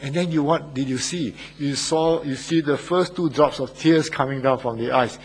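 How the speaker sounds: usual speaking voice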